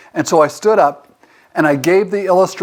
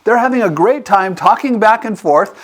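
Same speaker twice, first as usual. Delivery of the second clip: ordinary talk, nothing marked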